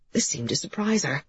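ordinary delivery

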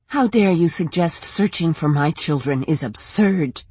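ordinary delivery, nothing marked

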